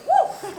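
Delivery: high winded whoop; a puffed-out high cheer; breathless woo. Real, not acted. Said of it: "So out of breath".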